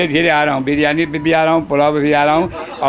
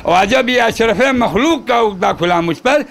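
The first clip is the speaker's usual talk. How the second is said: measured, projected mushaira-style recital; poem recitation; 5 semitones higher than usual. Acted. Poet reciting praise verse to gathering, declaimed. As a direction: declaim the couplet, lingering on line endings.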